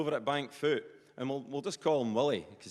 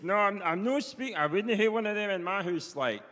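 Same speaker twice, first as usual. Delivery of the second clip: higher-pitched, suspicious Perthshire farmer; Willie; 5 semitones higher than usual. Retold or imitated. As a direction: warn them in broad Perthshire that they're being bugged. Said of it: "He says".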